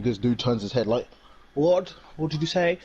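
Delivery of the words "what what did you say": high, sudden surprised question; startled What. Retold or imitated. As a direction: snap your head round at the name. Grogu turning at his name.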